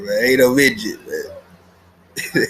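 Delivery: ordinary talk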